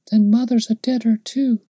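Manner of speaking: put-on high child's voice, mildly tearful; crying little girl. A narrator's reading. Little girl learns Mother is dead, high and upset. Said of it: "cried the little girl".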